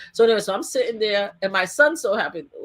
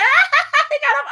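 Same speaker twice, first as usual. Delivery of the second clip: mocking crowd; 6 dB louder than usual. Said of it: "everybody surrounding us and like".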